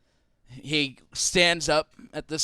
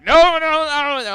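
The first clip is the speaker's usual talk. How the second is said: alarmed shouting; 13 semitones higher, 9 dB louder than usual. Acted. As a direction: hold him back with desperate cries. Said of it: "they're like".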